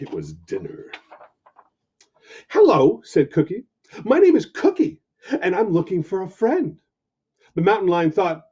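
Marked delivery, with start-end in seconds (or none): 2.5-3.0 puppy voice. Acted. Puppy greets lion. 4.0-6.8 puppy voice. Acted. Puppy introduces himself.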